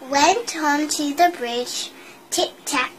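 ordinary delivery, nothing marked

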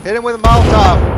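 loud urgent command; urgent shout. Real, not acted. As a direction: bark the order.

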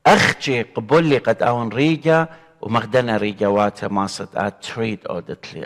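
normal talking voice

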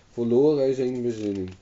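plain reading voice

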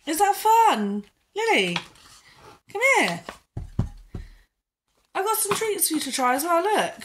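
bright sing-song voice for the dog; high, excited coaxing of the pet; high dog-talk voice. Real, not acted. Owner calling her dog over in high pitch.

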